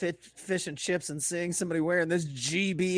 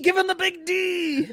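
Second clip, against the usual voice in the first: mock shout; 10 semitones higher than usual. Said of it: "He's like".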